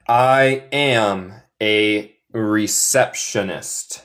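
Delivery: slow, separated word by word; repeat after me. Shown as a demonstration. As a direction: model it slowly for learners to repeat. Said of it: "Y repite después de mí". (despacio, palabra por palabra, para repetir)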